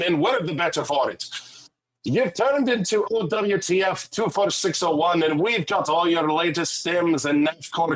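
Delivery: radio patter in a put-on Scottish-Irish brogue; Scottish-Irish accent. Shown as a demonstration. Host announcing the radio station, in a put-on Scottish-Irish accent. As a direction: greet listeners in a mixed Scottish-Irish accent. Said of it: "I did do a Scottish-Irish accent".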